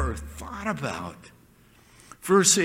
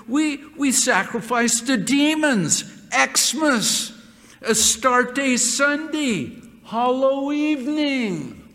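higher and louder, building; rising preaching fervor; 6 semitones higher, 3 dB louder, slower than usual. Acted. Preacher building fervor over the holidays. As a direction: preach it harder with each holiday.

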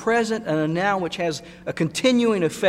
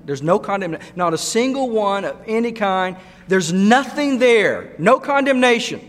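building from plain to loud and much higher; preaching crescendo; 6 semitones higher, 5 dB louder than usual. Acted. Preacher insisting there's no condemnation, rising to a shout. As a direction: climb to a ringing peak.

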